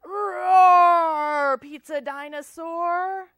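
loud, high, drawn-out roar; roaring dinosaur greeting. Acted. Child greeting a pizza dinosaur, with loud playful roar. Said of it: "What if it's a pizza dinosaur?".

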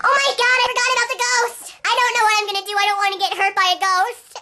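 put-on high, frantic childish wail; acted whiny panic; panicked. Panics on remembering the ghost.

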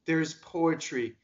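usual speaking voice

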